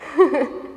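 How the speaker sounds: short sheepish laugh; embarrassed laugh. Real, not acted. Embarrassed about her breathing.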